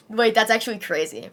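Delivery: ordinary talk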